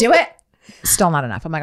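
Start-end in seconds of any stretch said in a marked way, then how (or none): 0.0-0.3 psyching up. Retold or imitated. Psyching herself up to push.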